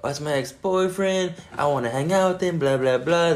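high, mock-naggy friend voice; nagging. Friend nags to hang out with her ex, mock nagging. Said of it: "getting on Nagy".

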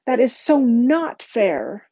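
high, indignant, stretched-out complaint; outraged. A narrator's reading. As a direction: protest slowly, leaning hard on the words. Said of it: "outrage in her voice".

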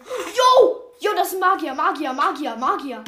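very loud and high, thrilled; excited yell. Real, not acted. Spots a mage, yells excitedly.

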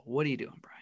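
whisper. Real, not acted. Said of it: "whispered aside to himself".

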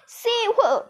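high-pitched cry for help, acted; screamed plea. A narrator's reading. Girl pinned by squirrels begs to be saved, acted scream. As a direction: call out for rescue in a storyteller's scream. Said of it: "screamed Veruca Salt".

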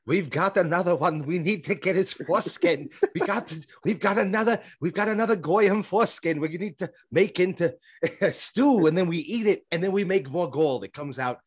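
high, loose mock Yiddish accent. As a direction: ham up an old-world Yiddish accent, pitched high. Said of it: "is this your Yiddish accent".

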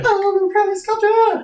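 very high, slow, stretched title; squeaky character voice. Acted. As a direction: squeal the show title like a cartoon kid.

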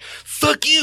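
very high bratty yelp; troll boy. Acted. Troll boy to his brothers, shrieking high. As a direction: shriek it like a brat running off. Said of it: "he's like".

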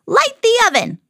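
high-pitched storybook witch holler; hollered order. A narrator's reading. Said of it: "she hollered".

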